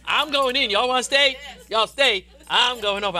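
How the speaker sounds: Bill Winston's vow of defiance; defiant preacher quote. Retold or imitated. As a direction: refuse to be held back. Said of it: "He said".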